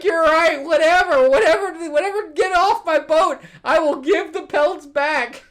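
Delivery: high, loud, hammed-up giving in; mock exasperated surrender. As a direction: imitate someone frantically conceding. Acts out Hook giving up.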